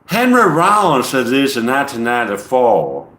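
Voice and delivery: mock Southern accent; Southern send-up. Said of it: "trying to do a send up to the Southern accent".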